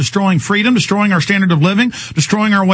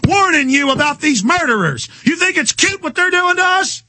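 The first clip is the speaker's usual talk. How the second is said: higher, heated telling-off of a cop; retold angry rebuke; 8 semitones higher than usual. Host retells scolding the FBI guy, higher and heated. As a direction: snap at him like he's an idiot. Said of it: "And I'm like".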